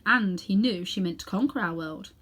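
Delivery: ordinary delivery, nothing marked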